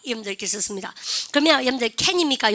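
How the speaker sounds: ordinary delivery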